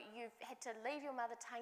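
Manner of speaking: ordinary talk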